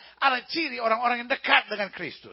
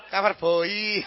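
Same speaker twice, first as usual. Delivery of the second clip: mock Javanese accent, heavy breathy B in "boy"; 3 semitones lower, 6 dB louder, much slower than usual. Preacher showing how Javanese people say it, mock Javanese accent. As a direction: say "Cover boy" with a thick, drawn-out Javanese B. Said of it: "Orang Jawa kan nggak bisa bilang cover boy".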